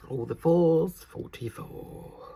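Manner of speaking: loud, high bingo call fading out; bingo caller. Pretend. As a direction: call it like a bingo hall host. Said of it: "I could be a bingo caller".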